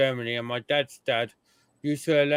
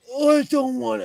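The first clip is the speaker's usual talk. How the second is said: much higher and louder put-on spirit voice; mock spirit voice; 10 semitones higher, 5 dB louder than usual. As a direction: imitate a medium voicing a spirit, high and loud. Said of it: "he puts on a voice, an accent".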